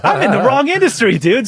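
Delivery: high-pitched, exasperated realization; amused disbelief. Acted. Musician comparing his pay to tribute bands, incredulous. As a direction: pitch it up in mock outrage.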